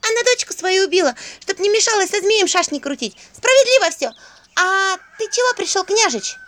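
put-on very high, loud piping child; shrill possessed child's voice; squeaky child voice. A narrator's reading. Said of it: "Голосок был детским, писклявым".

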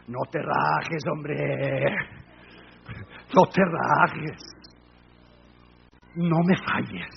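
low, slow plea to God; quietly implore, stretching the words; pleading prayer. Retold or imitated. Preacher retelling his morning prayer to the Lord, low pleading. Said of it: "Y yo oré al Señor".

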